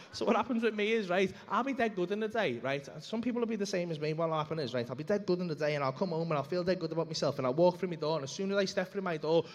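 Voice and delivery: ordinary talk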